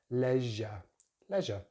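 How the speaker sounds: "Leisure" as LEZH-uh; "Leisure" with short e, no final r; British pronunciation. Shown as a demonstration. Teacher shows short vowel, "Leisure" as LEZH-uh. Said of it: "using the short vowel sound".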